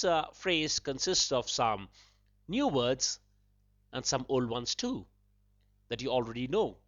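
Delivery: normal talking voice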